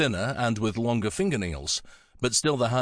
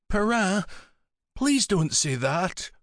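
put-on high, slow, pleading child's voice; little girl voice; 9 semitones higher, slower than usual. A narrator's reading. Melanie begging her godfather, little girl's voice. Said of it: "in the voice of a little girl".

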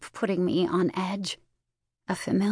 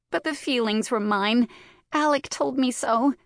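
tearful; 5 semitones higher than usual. A narrator's reading. Sister still crying.

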